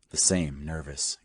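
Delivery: plain reading voice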